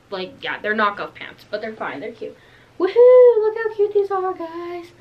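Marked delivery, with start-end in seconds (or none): 2.8-4.9 excited cheer. Real, not acted. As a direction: squeal with delight as you show them off. Cheering while showing new pants.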